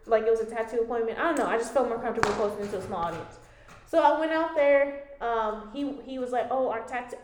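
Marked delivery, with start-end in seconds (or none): none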